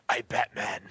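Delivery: mock superhero announcement; Batman. Joking way to confuse the drunk, mock superhero voice. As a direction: lightly put on a caped-hero voice. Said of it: "just turn around and say".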